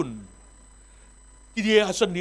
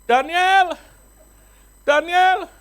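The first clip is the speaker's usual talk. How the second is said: stern loud call; 9 semitones higher, 8 dB louder, much slower than usual. Acted. Angry pastor calling his son across the house. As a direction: call the boy's name sharply and loud.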